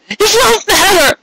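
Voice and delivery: slow, high hopeless cry; high tearful despair. Acted. Cupcake to Carrot, high and hopeless. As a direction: let it fall in despair. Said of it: "she said".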